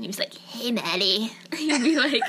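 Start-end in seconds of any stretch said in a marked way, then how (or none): 0.4-1.3 whispery low guy voice; hushed, breathy imitation of a shy guy; put-on whispered greeting; guy impression. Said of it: "my best impression".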